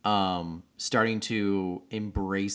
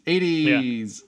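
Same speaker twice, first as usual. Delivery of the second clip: mock exclaim; 4 semitones higher, 5 dB louder than usual. Shown as a demonstration.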